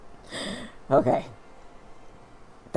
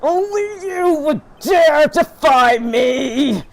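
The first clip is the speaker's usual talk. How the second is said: slow, much higher, much louder villain rage; furious roar; 12 semitones higher, 8 dB louder, much slower than usual. Acted. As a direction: roar it out like a madman. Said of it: "sorry about y'all's ears".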